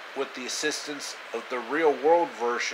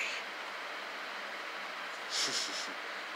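soft breathy evil chuckle; a quiet sinister laugh; hushed wicked laugh; villain chuckle; 5 semitones lower, 16 dB quieter than usual. Acted. Villain laughs after threat.

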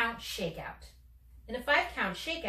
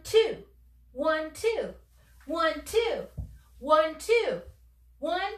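higher, louder rhythmic counting for the shakeout; 6 semitones higher, 3 dB louder, much slower than usual. Shown as a demonstration. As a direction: call out each count with energy. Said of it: "Let's do it together".

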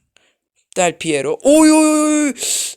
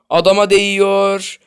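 frustrated yell; 3 semitones higher, 11 dB louder than usual. Real, not acted. Frustrated loud complaint.

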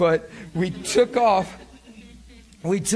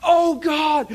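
high-pitched, elated whoop of praise; reliving a joyful yell on a run; ecstatic yelling; 11 semitones higher, 4 dB louder, slower than usual. Retold or imitated. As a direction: cry out in amazed delight, voice soaring. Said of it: "I'm laughing, I'm yelling".

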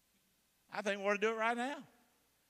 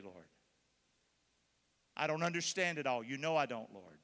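leading a prayer; 5 semitones lower than usual. Acted. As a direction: pray it humbly for the room. Leads a sinner's prayer.

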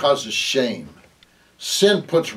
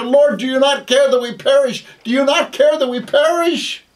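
high, loud acted plea; panicked shouting; 14 semitones higher, 6 dB louder than usual. Preacher as the twelve waking Jesus in the storm, panicked shout. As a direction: cry out as if the boat is sinking.

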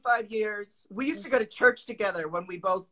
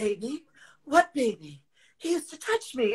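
overdramatic monologue; 4 semitones higher than usual. Retold or imitated. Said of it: "It was so dramatic".

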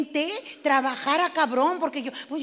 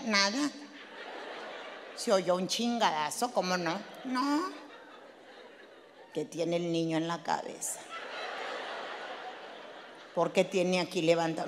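hushed; 8 semitones lower, 7 dB quieter than usual. Retold or imitated. Mother questioning, hushed retelling.

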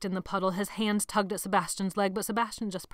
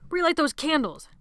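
loud, higher snapped command; shouted order; 8 semitones higher, 7 dB louder than usual. A narrator's reading. Sebastian shouting at the frozen boys to relight candles. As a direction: order them sharply.

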